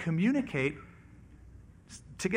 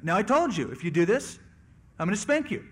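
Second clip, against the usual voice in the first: acted parent getting firmer and louder; raised-voice second warning to a child; higher, more forceful threat, short of yelling; escalating parental warning; 4 semitones higher, 4 dB louder than usual. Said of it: "until there's a peak level of volume".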